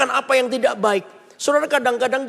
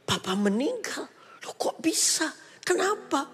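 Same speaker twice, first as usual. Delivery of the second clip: shocked whisper; 5 semitones higher, 6 dB quieter than usual. Retold or imitated. Retold shock at father's death.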